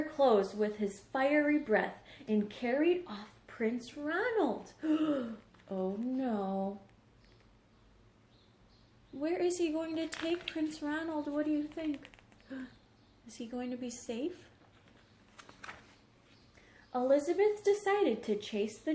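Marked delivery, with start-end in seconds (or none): 4.8-5.3 high staged gasp; mock gasp. Dragon carries off Ronald. 12.5-14.5 soft worried question; hushed concern for Ronald; mock worry.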